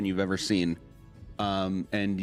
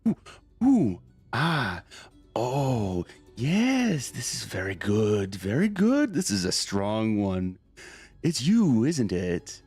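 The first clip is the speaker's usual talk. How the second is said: breathy, swooning blade spirit, pitch jumping; sentient sword voice; slower than usual. Acted. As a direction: coo over the wielder like a hungry sword. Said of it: "a little voice in the back of your head".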